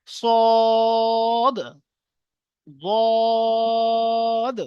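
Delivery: সদ and বদ held long with a rounded mouth, nearly sung; full rounded recitation. Shown as a demonstration. Teacher modelling the starred letters. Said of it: "মুখ গোল করে পুর করে পড়তে হবে".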